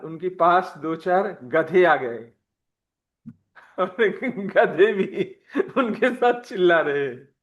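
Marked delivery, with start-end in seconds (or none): 3.8-7.3 laughing while talking. Real, not acted. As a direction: struggle to tell it through laughter. Laughs at donkeys joining the singing. (हँसी के साथ बोलना)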